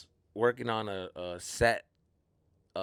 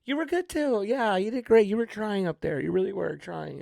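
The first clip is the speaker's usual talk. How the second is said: high-pitched, patronizing encouragement; mock consoling praise; 11 semitones higher, 5 dB louder than usual. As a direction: imitate an overly sweet pity compliment. Guests' hollow consolation to bombed comic.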